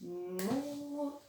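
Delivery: "Moto" softly, with a long rising mooo, then to; stretched syllables. Shown as a demonstration. Teacher helping catch the syllable, "Moto" soft and stretched. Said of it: "la syllabe qui chante M".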